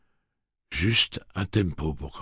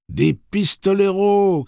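slow, high, loud cry from the crowd; 11 dB louder, slower than usual. Acted. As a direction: shout it out with awe. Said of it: "cria une voix".

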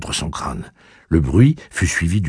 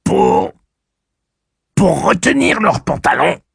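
grudging machine voice; 13 semitones higher, 7 dB louder than usual. Acted. Blaine grudgingly answering.

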